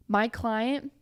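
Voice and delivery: ordinary talk, nothing marked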